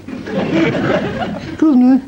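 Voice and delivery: high, slow, stretched polite English voice; posh Englishman. Acted. Englishman to farmer, high prim politeness. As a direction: put on a prim English gent asking for help.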